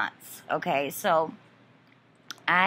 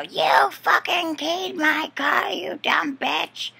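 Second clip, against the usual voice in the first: a higher, slower screaming accusation from a car; screamed accusation; 9 semitones higher, slower than usual. Retold or imitated. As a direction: yell it out the car window at her. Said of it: "screams out the window".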